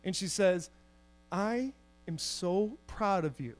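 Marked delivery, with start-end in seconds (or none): 1.3-3.6 slow, hushed, mock-tearful pride; tearfully proud. Friend's mom moved by his costume, tearful and hushed. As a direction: softly act out a mom moved to tears. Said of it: "Tears start running down her face".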